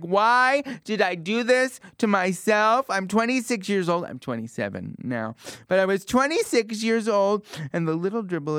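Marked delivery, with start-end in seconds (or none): 0.0-4.0 mock screaming cry. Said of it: "screaming and crying and moaning like". 5.7-7.5 twenty-six punched higher and louder; stressed age. Acted.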